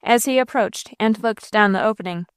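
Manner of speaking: plain reading voice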